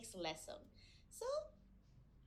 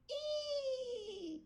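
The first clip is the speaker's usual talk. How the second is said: high squealing neigh; whinny like a horse for the kids; playful high horse cry; 11 semitones higher than usual. Shown as a demonstration. Teacher imitates the horse.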